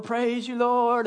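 high-pitched mock church cheer; put-on happy praise. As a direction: fake a happy, pious praise. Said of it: "they put on their happy face".

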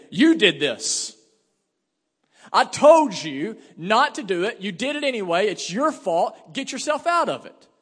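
loud, high-pitched, drawn-out accusation; acted angry telling-off; stern scolding parent. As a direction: scold hard, hammering out each charge slowly and loudly.

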